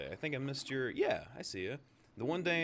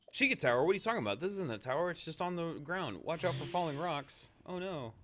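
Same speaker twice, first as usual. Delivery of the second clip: higher, louder put-on voice; character voice; 8 semitones higher, 3 dB louder than usual. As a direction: play an indignant character, loud and high. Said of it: "I'll get back to doing voices".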